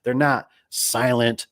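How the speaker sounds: drawn-out, slow joking explanation; slow deadpan punchline. Retold or imitated. Vendor explaining Silent Glide to Dave, slow deadpan. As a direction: stretch each word like a punchline. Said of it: "he goes".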